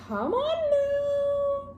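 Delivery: very high, slow, stretched wail; overwhelmed squeal. Real, not acted. Vlogger longing to read volume fifteen, squealing. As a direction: wail it out high, overcome with excitement.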